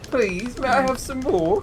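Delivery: high pleading orphan voice; street urchin voice. Acted. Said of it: "you sound like a little street urchin right now".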